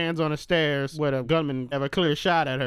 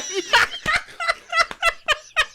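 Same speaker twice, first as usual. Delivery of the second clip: high cackling laugh; 4 dB louder than usual. Real, not acted. Cracking up.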